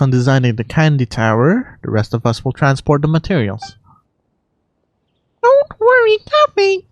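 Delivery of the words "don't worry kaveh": put-on a sweet high-pitched girl reassuring a friend; high put-on character voice. Character reassuring Kaveh about his design, in a high sweet voice. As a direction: voice it much higher, gentle and encouraging.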